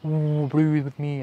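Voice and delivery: voice of the wind. Retold or imitated. Channeling the wind elemental, slow airy wind voice. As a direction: stretch the woo out like a gust breathing. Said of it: "instantly the message from the wind".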